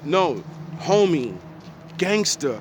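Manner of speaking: normal talking voice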